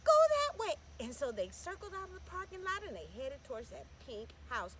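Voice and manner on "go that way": much higher and louder; a girl shouting as she recognizes home; loud, thrilled little girl; excited child. Acted. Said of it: "She said".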